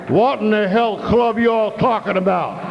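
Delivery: gruff Texan. Retold or imitated. Big Texan confronts him.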